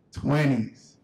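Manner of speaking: plain reading voice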